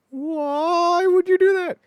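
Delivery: long, high, loud mock wail; hammed-up anguish, stretched out slowly; horrified wail. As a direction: cry out like an appalled sitcom star. Horrified reply repeated in sitcom.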